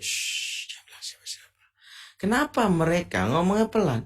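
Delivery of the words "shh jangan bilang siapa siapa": hushed secret whisper; secretive lover's whisper. Shown as a demonstration. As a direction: whisper it so no one else hears. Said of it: "Bisik-bisik".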